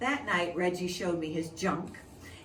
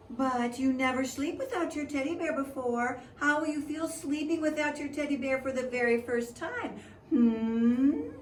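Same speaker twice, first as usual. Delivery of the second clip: teasing sister; 6 semitones higher, 3 dB louder than usual. Acted. Sister teasing about teddy bear.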